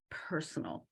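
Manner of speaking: whispered aside. Real, not acted. Telling the panel something private, whispered. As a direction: drop to a hush, confidential.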